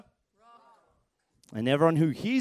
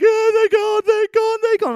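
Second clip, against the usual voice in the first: put-on high, loud wailing kid; wailing child; 10 dB louder than usual. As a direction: wail it like a heartbroken boy. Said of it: "And my son's like".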